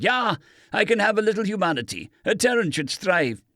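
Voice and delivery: lightly voiced German doctor, careful and calm; understated doctor insisting he is no pirate; captive doctor. A narrator's reading. Doctor answers.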